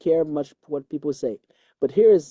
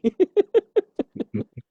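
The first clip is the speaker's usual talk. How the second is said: high laugh; 14 semitones higher, 4 dB louder than usual. Real, not acted. Laughing after punchline.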